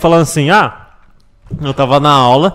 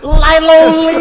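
high mock voice; 11 semitones higher, 4 dB louder, much slower than usual. Mock Chinese name.

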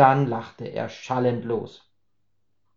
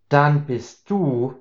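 stunned; much slower than usual. Acted. Herbert stunned realization.

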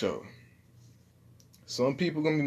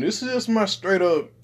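higher and louder street voice; 9 semitones higher, 5 dB louder than usual. Shown as a demonstration.

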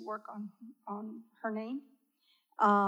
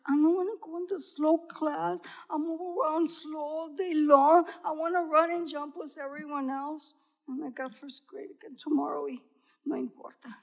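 put-on upset little girl; 5 semitones higher, 3 dB louder than usual. Child upset at slow class.

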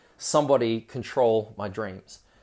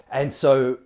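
ordinary delivery

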